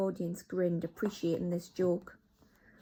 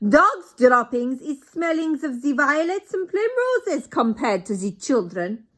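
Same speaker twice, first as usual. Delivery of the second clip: put-on higher, loud, slow accented witch voice in broken English; Grand High Witch; 7 semitones higher, 11 dB louder than usual. Grand High Witch mocks the children, loud accented. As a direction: savour the insult.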